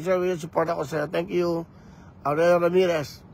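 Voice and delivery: ordinary talk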